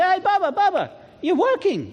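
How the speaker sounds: high, animated hailing voice; retold street greeting. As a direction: call out to a friend, pitched well up. Voicing chat with Malawian guard.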